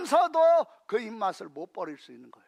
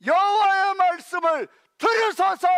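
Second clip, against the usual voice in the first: urgent plea; 8 semitones higher, 7 dB louder than usual. Real, not acted. Preacher urging the congregation to listen, shouting. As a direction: shout "들으소서" loud and higher. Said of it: "preaching climax".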